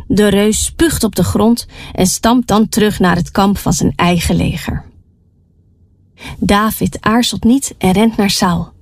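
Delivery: ordinary delivery, nothing marked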